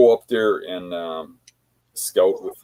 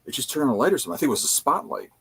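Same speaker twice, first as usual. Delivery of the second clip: higher-pitched imitation of a watching buddy; narrating play-by-play; 7 semitones higher, much faster than usual. Hunter to camp about the truck's lights, imitated narrating. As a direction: imitate someone reporting each thing as it happens. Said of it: "like, narrating it".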